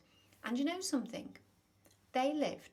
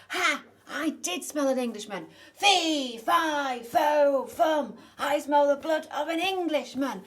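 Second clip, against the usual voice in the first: very loud, higher gloating bellow; triumphant giant roar; 7 semitones higher, 15 dB louder, slower than usual. Acted. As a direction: crow over the caught boy at full blast. Giant spots Jack, roars.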